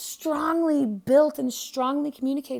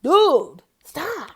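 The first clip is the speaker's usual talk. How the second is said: snapping at yourself; 6 semitones higher, 11 dB louder than usual. Pretend. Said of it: "don't you just wish that, like".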